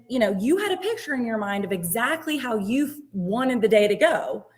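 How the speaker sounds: ordinary talk, nothing marked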